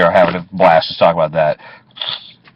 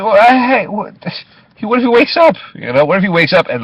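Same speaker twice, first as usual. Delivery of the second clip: high, anxious imitation of himself fretting he'll wake; mock worried plea; 13 semitones higher than usual. Cab driver pleading with departing witnesses, mock worried and high. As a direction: pitch way up, fretting that the knocked-out guy revives.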